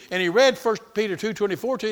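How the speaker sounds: usual speaking voice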